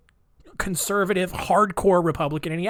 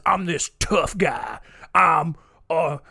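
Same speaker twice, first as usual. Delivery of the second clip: mock tough guy; slower than usual. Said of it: "the persona more often than not is".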